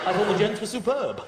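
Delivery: lightly posh English player; a clipped upper-class English reply; posh English rugby player praising the team; posh English accent. Retold or imitated. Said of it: "they're so posh".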